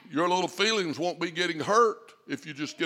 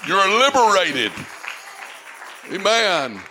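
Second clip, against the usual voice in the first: loud, driving preacher's build; full-force preaching; 3 semitones higher, 8 dB louder than usual. Acted. Pastor telling the church they're set free, preaching full force. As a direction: preach it hard and fast.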